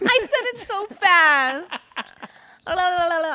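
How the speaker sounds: high, loud squeal into babble; loud, high-pitched mock gushing; excited squeal. Real, not acted. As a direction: squeal it out, then babble la-las. Teased for gushing too fast.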